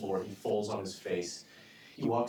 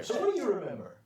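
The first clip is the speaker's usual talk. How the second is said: loud demand; 9 semitones higher, 4 dB louder, much faster than usual. Acted. Villain presses witness.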